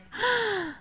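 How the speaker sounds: sharp high gasp of wonder; childlike gasp of amazement; awed gasp. Retold or imitated. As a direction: gasp like a kid seeing magic. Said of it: "And we were like".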